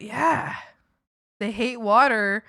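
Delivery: ordinary talk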